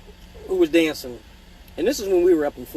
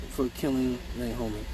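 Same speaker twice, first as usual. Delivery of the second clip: normal talking voice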